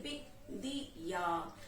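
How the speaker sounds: बिंदिया low and slow, "bin... dii... yaa"; syllable by syllable. Shown as a demonstration. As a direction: break बिंदिया into separate syllables. Teacher sounds out word by syllable.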